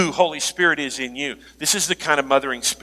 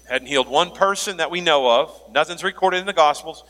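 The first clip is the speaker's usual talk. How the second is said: usual speaking voice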